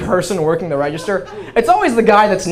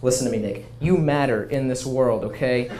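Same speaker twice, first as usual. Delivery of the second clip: soft, lower, earnest reassurance; reassuring; 7 semitones lower, 9 dB quieter than usual. Retold or imitated. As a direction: talk him off a ledge gently. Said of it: "Her exact response".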